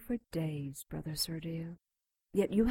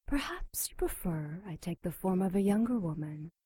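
seductive whisper. Acted. Demon offers a new form.

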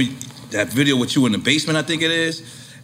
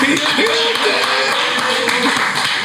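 stretched long and pitched way up; drawn out high; much slower than usual. Real, not acted. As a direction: drag it out, high and amazed.

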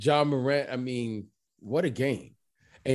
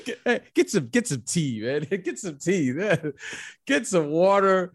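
laughing, high teasing voice; laughing tease; 5 semitones higher than usual. Real, not acted. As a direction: tease him through laughter. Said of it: "teasing after the announcer bit".

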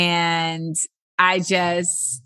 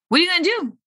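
much higher playful echo; pitched-up repeat; 10 semitones higher, much faster than usual. Acted. Host to forty-year-olds, repeating the question much higher. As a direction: toss the question back up high.

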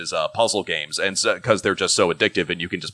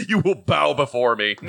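megalomaniac rant; 7 semitones higher than usual. Pretend. Jokes a megalomaniac kid.

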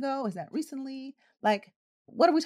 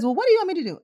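raised, higher voice of a fed-up nurse; louder, frustrated; 8 semitones higher, 4 dB louder, much faster than usual. Retold or imitated. Nurse challenged about the monitor, voice rising and louder. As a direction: push back, getting louder. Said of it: "the tone was getting a little louder too".

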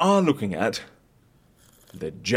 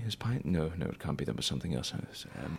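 much softer, half-whispered fumbling sums; muttering to himself; 10 semitones lower, 16 dB quieter, much faster than usual. Acted. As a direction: lose yourself in muddled mental arithmetic. Mumbling calculations to himself.

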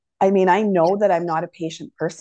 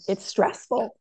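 hoarse, voice failing; weak hoarse voice, losing it; voice giving out; 2 semitones higher, slower than usual. Real, not acted. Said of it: "Losing my voice".

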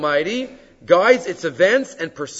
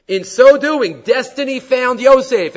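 louder, higher, slower proclamation; emphatic climax; 8 semitones higher, 9 dB louder, slower than usual. Acted. Climax of the reading.

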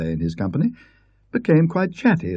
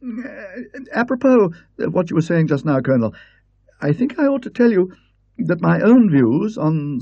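high, careful scholar declaring his views; a prim don, hesitating then firm; hesitant Parkins; 8 semitones higher than usual. Acted. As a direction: Parkins confessing disbelief to the Colonel. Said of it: "with a slight hesitancy".

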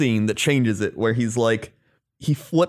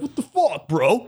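mock outrage; 9 semitones higher than usual. Kareem flips out, throws chair.

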